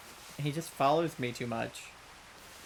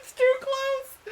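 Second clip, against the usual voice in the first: shrieking laugh; 6 dB louder, much slower than usual. Real, not acted. Pokemon follower too close to camera.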